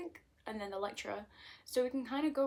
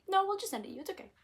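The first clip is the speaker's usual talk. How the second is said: cheerful company voice; 11 semitones higher, 7 dB louder, faster than usual. Retold or imitated. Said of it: "And they went".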